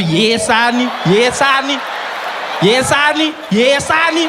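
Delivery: each yes honey climbs up to a shriek; mock colored wife. Imitating a wife answering, rising each time.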